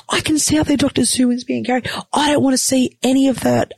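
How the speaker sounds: high, squeamish protest acted out; alarmed protest. Patient objecting to surgeons about seeing her operation, alarmed protest.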